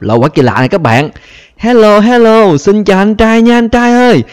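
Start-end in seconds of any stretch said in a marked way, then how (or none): 1.6-4.2 high calling out. Real, not acted. Calling out to a stranger.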